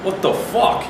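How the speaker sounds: half-acted disbelief at the pervert; retold outburst. Angry reaction on the train.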